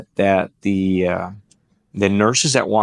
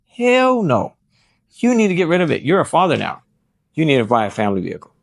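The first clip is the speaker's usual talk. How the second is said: nurse scolding; 6 semitones higher, faster than usual. Retold or imitated. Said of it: "she was like".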